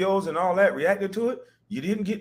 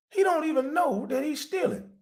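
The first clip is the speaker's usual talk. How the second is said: mocking; 12 semitones higher, 4 dB quieter than usual. Mocking the junkie thief.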